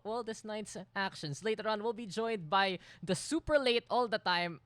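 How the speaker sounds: ordinary talk, nothing marked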